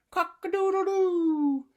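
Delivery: high, loud, slow crow; rooster crow. Acted. The startled fowl crowing, high, loud and drawn out. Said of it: "who cried".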